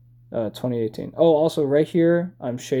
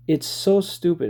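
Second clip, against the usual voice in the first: ordinary talk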